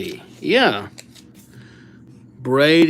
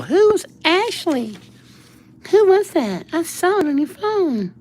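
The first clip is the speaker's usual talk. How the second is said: put-on jealous girlfriend. A girlfriend questioning him over another girl's name, high jealous voice. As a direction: interrogate him like a suspicious girlfriend.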